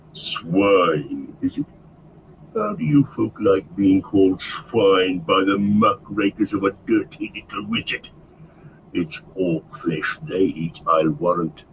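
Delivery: low orc scoffing; lightly voiced gruff orc chief mocking; low and contemptuous; Ugluk scornful. A narrator's reading. Ugluk answers insult.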